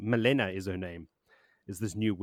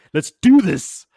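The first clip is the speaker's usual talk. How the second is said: much higher and louder, fired up; pumped-up battle cry; 9 semitones higher, 16 dB louder, slower than usual. Acted. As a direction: shout it like charging into battle. Nynaeve charges into the archway.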